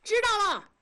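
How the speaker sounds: a hint of a kid's high reply; boy calling back to his mother, high child voice; child answering. A narrator's reading. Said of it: "狗子答应着".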